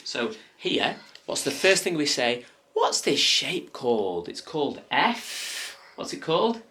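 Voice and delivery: gentle, sing-song coaxing voice; reading to a toddler. Acted. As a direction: coax a toddler kindly. Coaching toddler through letters.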